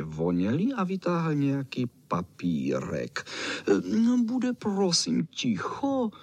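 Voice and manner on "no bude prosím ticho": high, drawn-out fussy dog. Acted. Čau Čau demands silence for the song, high and fussy.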